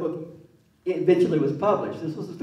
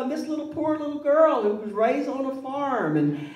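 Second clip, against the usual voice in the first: raised-pitch recap of the story as mom; mother voice continued; 7 semitones higher than usual. Retold or imitated. Son keeps imitating his mother retelling the book, raised pitch. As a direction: keep mom's high voice while listing the plot. Mother's summary still in her voice.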